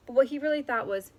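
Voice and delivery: ordinary delivery, nothing marked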